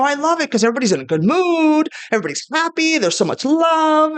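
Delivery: high-pitched, upbeat gush; retold gushing. Telling a negative ex why Pride is great, high gushing. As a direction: gush it high, stretching the last phrase.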